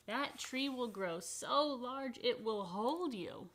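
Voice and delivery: picture book read-aloud; reading to children. Acted. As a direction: read it with awe.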